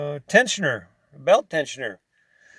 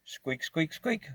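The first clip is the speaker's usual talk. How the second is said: squeak imitation; 15 dB quieter, slower than usual. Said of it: "start doing that".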